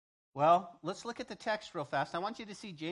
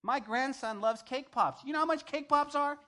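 exasperated; 8 semitones higher, 11 dB louder than usual. Real, not acted. Exasperated price rant.

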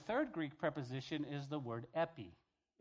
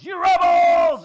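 slow, far higher, very loud shout; furious shout; 13 semitones higher, 23 dB louder, much slower than usual. Retold or imitated. Moses at the children of Israel, slow furious shout. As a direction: roar it at the crowd. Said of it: "he goes".

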